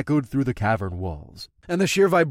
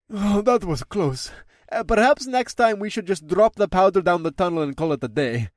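relieved dragon; 6 semitones higher than usual. Acted. Dragon telling his rider, relieved and higher. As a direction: exhale the close call.

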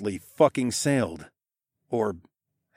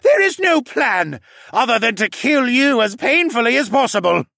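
menacing villain; 13 semitones higher, 8 dB louder than usual. A narrator's reading. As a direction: threaten like a cruel councilman. Hess threatens.

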